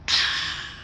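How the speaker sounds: long, airy acted exhale; slow breath out, no voice; calm sigh. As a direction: let out an unbothered sigh. Koda's calm sigh in answer.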